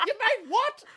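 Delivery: a high, laughing mock-mum squawk; high mock disbelief. Mum hearing they made napalm, high mock disbelief. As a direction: squeak it out in disbelief.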